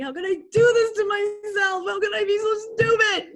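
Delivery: high, loud shout at herself; self-berating. Retold or imitated. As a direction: yell at yourself in frustration on the table. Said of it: "going like that on the table and being like".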